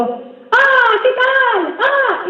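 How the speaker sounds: very high A with no chest resonance; misplaced A. Shown as a demonstration. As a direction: push the Ah up into an artificial high pitch. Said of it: "esa A no me hace ningún masajito". (una A muy aguda, sin vibrar en el pecho)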